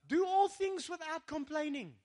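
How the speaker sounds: loud, high, slow declaration; preacher's forceful proclamation; emphatic scripture quote. Real, not acted. Hammers the verse home again.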